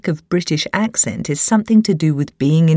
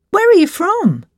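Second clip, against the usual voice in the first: curious, higher-pitched question; lightly acted curious stranger; questioner; 13 semitones higher, 3 dB louder than usual. New acquaintance asks the writer where she is from, questioner. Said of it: "It often goes something like this".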